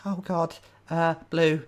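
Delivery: high, halting, frightened answer; nervous old lady. Acted. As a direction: play a cornered old woman pleading. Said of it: "looking at you very nervously".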